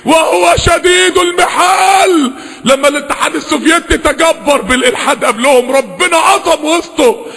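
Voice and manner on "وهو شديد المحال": high, slow proclamation; solemn, soaring warning from scripture; forceful recitation. Real, not acted. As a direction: ring it out, holding the long vowel. Proclaims God's might.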